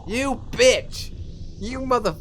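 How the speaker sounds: high, loud indignant cursing; outraged yell, breaking off mid-curse; angry outburst. Acted. Player's acted outburst at character.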